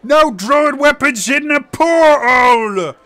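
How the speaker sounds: high, loud, slow yell; shouted rebuke. Acted. Tavern patron yells at the druid, loud high rebuke. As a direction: bark a rule across the room. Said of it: "another guy goes".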